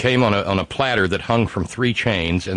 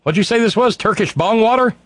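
waiter's mock taunt; 12 semitones higher than usual. Waiter teasing a customer about coffee, high mocking question. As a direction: needle him about the coffee, pitched high. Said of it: "the guy looks at me and goes".

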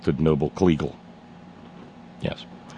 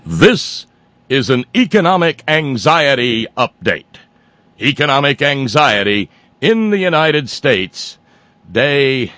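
higher, louder, formal news anchor read; newscaster voice; 6 semitones higher, 5 dB louder than usual. Pretend. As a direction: announce it like the evening news. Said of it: "let me get my newscaster voice".

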